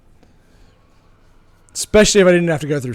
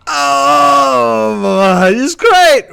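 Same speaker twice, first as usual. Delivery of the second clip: much higher, loud strained cry of forced delight; fake enthusiasm; 6 semitones higher, 9 dB louder, much slower than usual. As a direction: squeal it out like a cornered actress. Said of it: "Emilia Clarke ... did".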